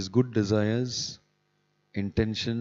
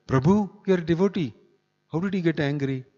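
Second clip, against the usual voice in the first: higher, louder accusing voice; mock reproach; 7 semitones higher, 5 dB louder than usual. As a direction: scold him in mock surprise. Voicing devotees' reproach.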